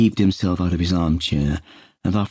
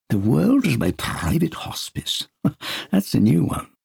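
amused Peter; 9 semitones higher than usual. Acted.

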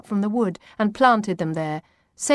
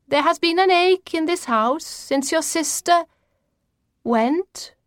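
high, portentous grandmother voice; mysterious grandmother; 9 semitones higher than usual. A narrator's reading. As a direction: pitch it high, like a grandmother announcing destiny.

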